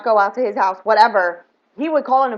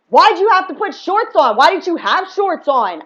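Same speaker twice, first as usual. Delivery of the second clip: higher, louder retold accusation; challenging the ex, higher and louder in outrage; indignant retold demand; 6 semitones higher, 4 dB louder than usual. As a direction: demand it in disbelief, voice up. Retold confrontation, shocked accusation.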